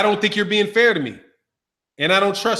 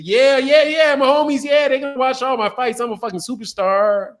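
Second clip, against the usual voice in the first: put-on higher-pitched, hyped Mexican-American homeboy accent; Chicano accent; 6 semitones higher than usual. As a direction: brag like a Chicano homie, pitched up. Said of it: "when I do the accent".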